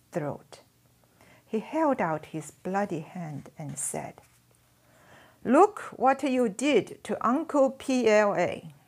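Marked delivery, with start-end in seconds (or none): 5.4-8.7 accusing. Acted. Soldier shows his bloody hand.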